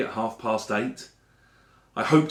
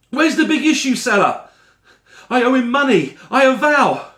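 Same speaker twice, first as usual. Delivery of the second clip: much higher and louder, frantic and pleading; distraught plea; 10 semitones higher, 8 dB louder than usual. Acted. Narrator begging a caring stranger about the seller, frantic and loud. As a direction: cry out desperate for the lost seller.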